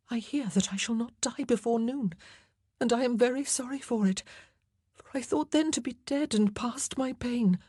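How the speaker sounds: a frightened queen half-whispering her wish to be done. A narrator's reading. Anne voiced, fearful before execution.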